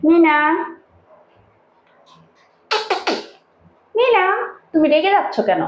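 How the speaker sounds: high-pitched call to a pet; high pet-talk, questioning; coaxing a pet. Real, not acted. Calling and coaxing annoyed pet.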